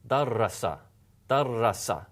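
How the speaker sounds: Darasa with a long, doubled r in the middle. Shown as a demonstration. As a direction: hold the r in Darasa for two beats. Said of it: "clearly a lengthening of that ra".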